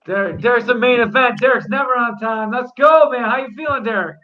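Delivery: loud, high hype greeting; excited hype. Real, not acted. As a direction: fire up the main event fighter. Host hyping late-arriving main event.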